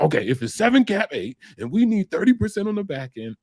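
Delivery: high-pitched imitation of a boss testing you; quizzing manager. Manager quizzing him, acted.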